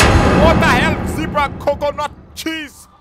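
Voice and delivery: shocked. Acted. Finds the room empty.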